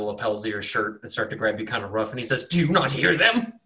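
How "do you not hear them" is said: higher, urgent demand; desperate cultist. Acted. Cultist gripping the cleric's lapels, desperate. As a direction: shake him for an answer.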